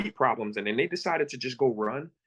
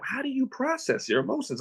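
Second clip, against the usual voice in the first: high-pitched puzzled question; mock baffled, pitched up; retold bewilderment; 11 semitones higher than usual. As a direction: ask it high, imitating your baffled self. Retelling own bewilderment at the idea.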